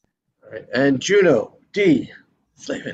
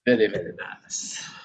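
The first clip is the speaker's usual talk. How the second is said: soft whispered praise; 4 semitones lower, 5 dB quieter than usual. Real, not acted. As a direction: breathe it out in a hush.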